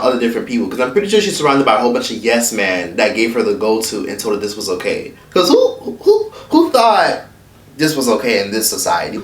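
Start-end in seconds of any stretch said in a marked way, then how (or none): 5.3-9.2 incredulous. Real, not acted. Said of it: "incredulous rhetorical question".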